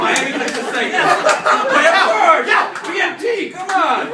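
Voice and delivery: loud, high, laughing cheer; laughing excitedly. Real, not acted. Host delighted by suggestion.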